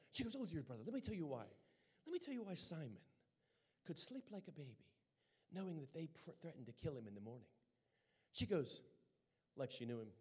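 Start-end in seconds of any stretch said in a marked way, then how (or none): 0.4-7.5 quick, lilting British explanation; lightly put-on British accent; imitated British accent. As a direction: explain like a British missionary sister. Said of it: "with her British accent".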